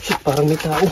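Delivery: startled. Real, not acted. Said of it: "natakot ako".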